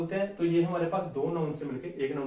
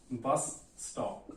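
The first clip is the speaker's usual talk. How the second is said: slow dictation; 5 semitones lower, 7 dB quieter, much slower than usual. Shown as a demonstration. Teacher dictates an example.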